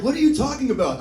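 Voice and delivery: mock baffled tough guy. Retold confused aggressor.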